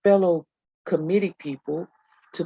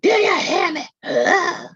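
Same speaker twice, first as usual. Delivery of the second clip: high, loud scolding shout; teacher screaming at the children; imitating a yell; 13 semitones higher, 6 dB louder than usual. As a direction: bark it at unruly kids. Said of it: "screaming at them".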